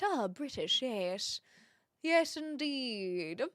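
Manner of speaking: mock posh British, quiet and drawn out. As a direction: put on a lightly hammed-up posh English voice. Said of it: "that is kind of the stereotype".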